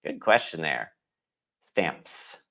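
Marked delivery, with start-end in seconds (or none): 1.6-2.4 "Stamps" said softly and carefully with the P; P pronounced. Shown as a demonstration. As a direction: sound out the P lightly in "Stamps". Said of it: "when I'm reading slowly, I say the P".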